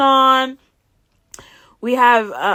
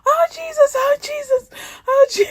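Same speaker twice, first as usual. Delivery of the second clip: calling on Jesus. Retold or imitated. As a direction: call out to Jesus in a high mock panic. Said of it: "I'm like, I'm calling".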